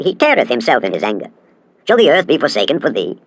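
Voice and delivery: ordinary delivery, nothing marked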